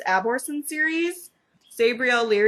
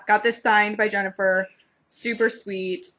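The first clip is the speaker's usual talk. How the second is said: normal talking voice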